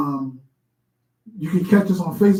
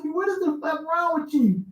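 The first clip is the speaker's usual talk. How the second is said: mock comedian; 9 semitones higher, 5 dB quieter than usual. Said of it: "He's like".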